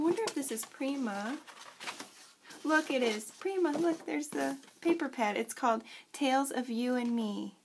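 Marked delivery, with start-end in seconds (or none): none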